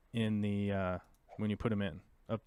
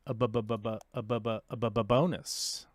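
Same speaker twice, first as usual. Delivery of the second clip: playful stutter. Pretend. Gamer joking about getting the most bonus, playful stutter. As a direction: stammer "bu-bu-bu" rhythmically into "bonus".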